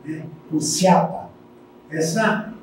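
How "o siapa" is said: siapa com SI no lugar do CH; dialeto italiano. Shown as a demonstration. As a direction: dizer siapa como os imigrantes. (siapa à moda dos imigrantes italianos)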